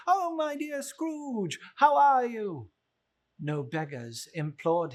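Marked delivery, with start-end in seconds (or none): 0.1-2.7 high, drawn-out friendly passerby; cheery greeting. Acted. Imagined passerby greeting Scrooge, high and gushing. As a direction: gush a hello at an old friend.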